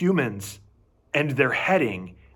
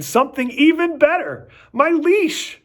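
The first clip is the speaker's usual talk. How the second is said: excited; 13 semitones higher, 6 dB louder than usual. Acted. Dog sees leash.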